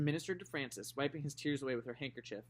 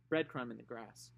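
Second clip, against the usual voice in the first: ordinary delivery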